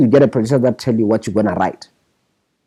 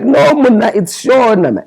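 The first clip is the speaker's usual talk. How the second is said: mock Tswana accent, pitched much higher and a bit louder; 11 semitones higher, 4 dB louder than usual. Shona correcting Muna on the phone, in a mock Tswana accent. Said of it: "he does it with that whole Tswana accent".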